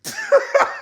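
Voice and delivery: brief real high laugh. Real, not acted. Host laughs at the shot at Remy, high. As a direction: chuckle up high.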